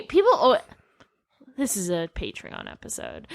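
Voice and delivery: ordinary talk, nothing marked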